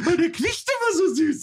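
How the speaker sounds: very high, cooing mock owner voice; doting owner. Owner gushing about the squealing dog, high and cooing.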